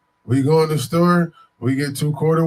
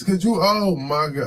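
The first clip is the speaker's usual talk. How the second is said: ordinary talk, nothing marked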